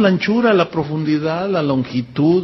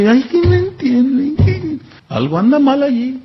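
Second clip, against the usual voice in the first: whiny complaint; 5 semitones higher than usual. Retold or imitated.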